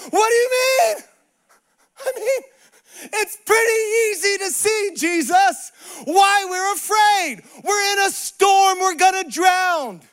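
frantic incredulous protest. Acted. Disciples to Jesus, we're gonna drown, exasperated shouting. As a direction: cry it out, stretching the words.

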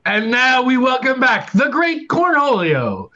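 slow, grand host announcement; announcer intro. Acted. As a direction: drag it out like a game show host. Host announces returning contestant.

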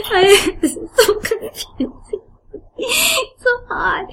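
high, squeaky words through laughter; real helpless laughter. Real, not acted. Reader losing it over the accents, laughing helplessly. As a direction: barely get words out for laughing.

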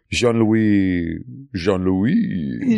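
mock French accent, lifting into a question; imitated bad French accent with a questioning end. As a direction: draw the name out in a put-on French accent. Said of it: "with her French accent again".

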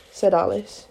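ordinary delivery

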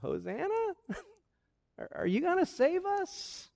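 tiny, high, hesitant voice; soft doubtful squeak, rising at the end; timid questioning. Acted. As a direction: ask meekly, unsure of rescue. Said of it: "instead of shouting, they kind of said".

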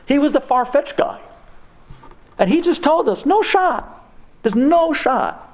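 worked-up, despairing lament, acted; imitated tearful despair. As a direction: plead in mock tearful despair, pitch climbing. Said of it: "crying, bawling tears".

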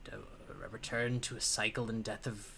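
hushed, breathless whisper. Acted. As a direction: whisper it like a secret. Warlord whispers.

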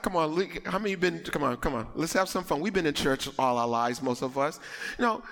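ordinary delivery

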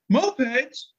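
barging in thrilled to hear about mopeds; eager guy from the crowd. Retold or imitated. Stranger at a concert overhears moped talk, excitedly high.

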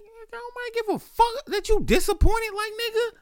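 high-pitched mock rant; imagined retort. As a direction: crank it way up and indignant. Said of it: "And in my mind".

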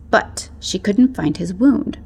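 ordinary delivery

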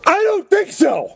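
very high, drawn-out mock squeal; neighbor's outburst. Neighbor heard through open garage at night, high hammed-up outburst. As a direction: imitate an overwrought neighbor at top pitch. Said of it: "one guy would go".

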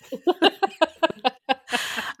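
high laugh at own joke. Real, not acted. Host amused by her own red flag joke, laughing. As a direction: crack up for a couple of beats.

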